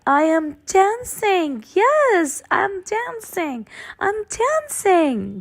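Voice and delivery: high, bright cry of joy, rising in delight; joyful cry. A narrator's reading. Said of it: "Gerald cried".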